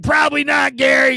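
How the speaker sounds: high, shouted mock reply; imitating Leo answering. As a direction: answer the host with a loud shrug. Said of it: "he's like".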